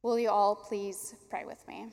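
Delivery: normal talking voice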